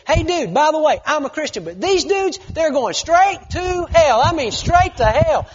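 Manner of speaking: loud, high, slow yell. Retold or imitated. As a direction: shout it out so the whole lift hears. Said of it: "And he yells it really loud".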